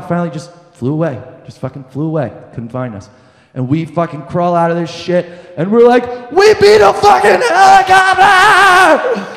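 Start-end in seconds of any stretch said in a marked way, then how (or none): 6.3-9.3 high, slow victory whoop; triumphant yell. Retold or imitated. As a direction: scream it like you just won everything. Crawling out triumphant.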